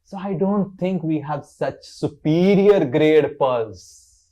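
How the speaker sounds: loud, slow, emphatic scorn; Lalita. Acted. Lalita rebuffs Krishna over his cows, angrily sarcastic. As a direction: draw out each point in mock-indignant sarcasm. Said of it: "Lalita looked angrily".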